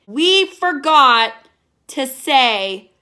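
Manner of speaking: slow, loud, broken into pieces; drawn-out exasperated repeat; incredulous outrage. Real, not acted. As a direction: hammer each word in disbelief. Incredulous repeat of plot point.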